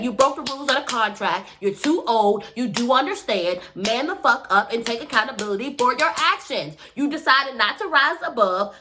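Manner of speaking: usual speaking voice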